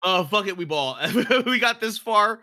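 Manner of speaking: loud laughing agreement. Real, not acted.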